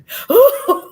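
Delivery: loud, high hammed-up sob gasp; mock crying wail. Shown as a demonstration. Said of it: "you really be crying".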